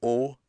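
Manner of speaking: "O" closed and rounded. Shown as a demonstration. Teacher contrasting two vowels, closed "O". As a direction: give a closed "O", as in boat. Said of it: "that difference of the sound".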